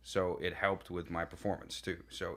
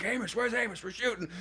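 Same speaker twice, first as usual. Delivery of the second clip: calling out; 13 semitones higher than usual. Retold or imitated. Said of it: "they're like".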